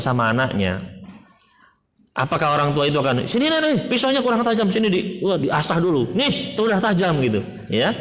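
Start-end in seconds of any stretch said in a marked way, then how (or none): 3.3-7.2 high, eager voice offering the knife; pitched way up, cheerfully egging the child on; careless indulgent parent. Pretend. Preacher voicing a careless parent handing over a knife, high and eager.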